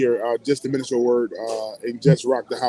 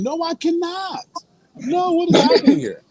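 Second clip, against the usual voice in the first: hammed-up horror at the request; exaggerated no way, much higher; mock disbelief; 3 dB louder than usual. Acting out refusing the caller.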